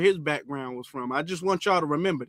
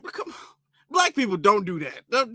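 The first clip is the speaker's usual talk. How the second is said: normal talking voice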